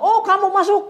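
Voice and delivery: high, loud welcome; recognising host. Acted. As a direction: call out in happy recognition. Acting host recognising a guest. (sambutan akrab dengan suara tinggi)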